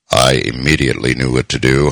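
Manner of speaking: ordinary delivery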